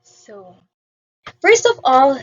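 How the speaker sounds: ordinary delivery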